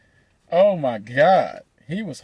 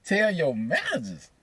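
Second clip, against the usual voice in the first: incredulous; 6 semitones higher, 5 dB quieter than usual. Retold or imitated. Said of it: "I'm like".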